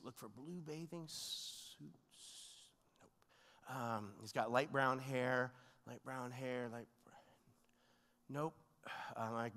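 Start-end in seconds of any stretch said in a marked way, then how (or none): 0.0-3.8 very soft, slow murmur while scanning; slow, absorbed muttering under the breath; hushed self-talk. Retold or imitated. Muttering to himself while searching. 5.9-8.5 muttered self-talk. Retold or imitated. Muttering to himself while searching.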